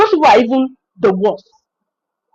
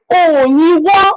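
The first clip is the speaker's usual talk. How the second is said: stretched Yoruba exclamation; 4 semitones higher, 4 dB louder, much slower than usual. Retold or imitated. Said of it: "if you don't understand Yoruba".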